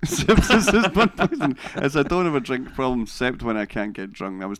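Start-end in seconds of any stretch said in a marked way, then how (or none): 0.0-1.6 louder, higher repeat through laughter; slow, laughing echo of the joke. Real, not acted. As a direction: say it back while cracking up.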